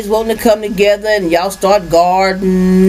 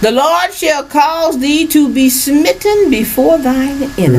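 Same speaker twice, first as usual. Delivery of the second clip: scripture reading; 7 semitones higher, slower than usual. Acted. Reads scripture aloud.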